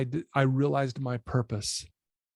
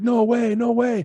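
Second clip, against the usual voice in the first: high, louder mock squeal of disbelief; freaking out; 8 semitones higher, 5 dB louder than usual. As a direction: imitate a little girl freaking out. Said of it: "like, freaking out".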